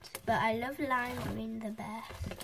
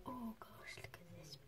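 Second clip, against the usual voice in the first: whisper; 6 semitones lower, 19 dB quieter than usual. Real, not acted. Hushed at a bubble.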